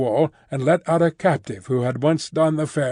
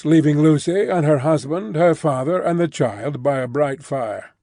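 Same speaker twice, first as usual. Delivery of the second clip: usual speaking voice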